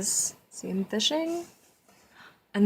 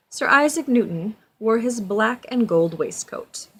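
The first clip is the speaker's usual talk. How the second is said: storybook narration; 6 dB louder than usual. A narrator's reading. Reads storybook aloud to child.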